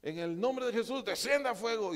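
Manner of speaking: high, loud mock call for fire from heaven; hammed-up zealous cry; mock fiery command. Disciples calling down fire.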